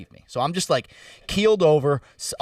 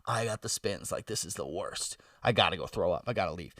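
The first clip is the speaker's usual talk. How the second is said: sick groaning; 7 semitones lower, 9 dB quieter than usual. Retold or imitated. Quoting himself, nauseous.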